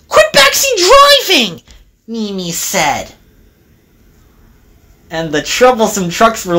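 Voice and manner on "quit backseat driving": squeaky high retort, dropping at the end; scolding engine. A narrator's reading. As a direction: tell off the trucks in a high voice. Engine snaps at goading trucks.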